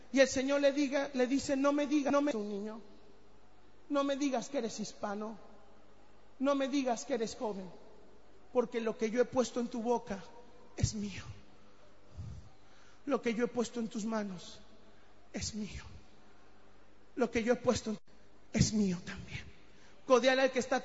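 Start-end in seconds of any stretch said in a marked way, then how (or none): 1.6-14.6 low, hushed voice of God speaking tenderly; a soft, low divine voice, almost whispered; God's voice. Acted. 15.3-15.8 breathy whisper of God; whispered, intimate divine claim. Acted. 17.1-19.4 low, hushed voice of God speaking tenderly; a soft, low divine voice, almost whispered; God's voice. Acted.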